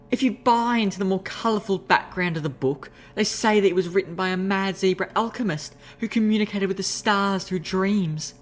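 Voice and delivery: high, juicy gossiping lady's voice. Acted. Rarity sharing juicy lore, high gossiping voice. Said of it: "She gave a smirk, gossip".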